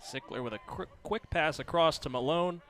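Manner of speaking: ordinary talk, nothing marked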